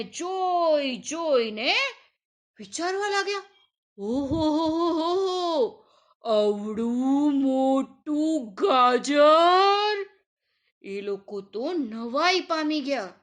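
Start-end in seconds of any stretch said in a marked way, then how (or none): 4.0-10.1 slow, drawn-out wonder; amazed. A narrator's reading. Everyone marvels at the huge carrot, slow amazement. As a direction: gasp at the giant carrot.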